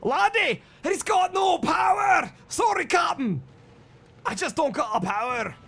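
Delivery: high, loud Scottish cry; Scottish accent. Acted. Engineer telling the captain there's no power, in a high, shouted Scottish accent. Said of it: "It's a great accent".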